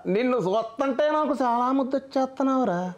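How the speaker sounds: a high, funny take on a grand hero speech; Sunil doing Baahubali. Acted. Mimic asked to repeat the Baahubali line, high comic hero voice. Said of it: "Prabhas గారు చెప్పిన బాహుబలి డైలాగ్ని సునీల్ గారు చెప్తే".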